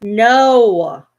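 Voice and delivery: loud, drawn-out no; sharp scolding. Real, not acted. Owner telling off the dog, loud scolding. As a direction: call out a long, forceful no.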